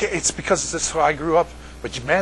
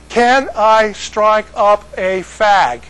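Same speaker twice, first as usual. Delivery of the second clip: very slow, higher, word by word; slowed right down; 5 semitones higher, 4 dB louder, much slower than usual. Retold or imitated. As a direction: spell it out slowly for a baffled listener. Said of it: "you gotta slow down".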